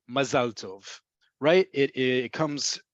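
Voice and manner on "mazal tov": ordinary talk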